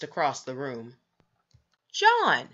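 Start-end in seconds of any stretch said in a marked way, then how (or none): 1.9-2.5 sharp, much higher cry of the name; shocked exclamation. A narrator's reading. Mother crying out as her son kicks the hammer away. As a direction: gasp his name out in alarm. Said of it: "exclaimed Missus Midas".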